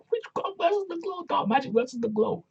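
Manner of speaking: playful falsetto matchup announcement; silly high voice. Acted. Host jokes about the match's witchy theme, silly high voice.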